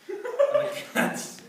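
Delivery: high, loud, slow words in laughter; squealing laugh. Real, not acted. Teller laughing as the cats attack, squealing. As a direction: let the laugh take the words.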